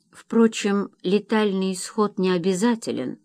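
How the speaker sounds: ordinary delivery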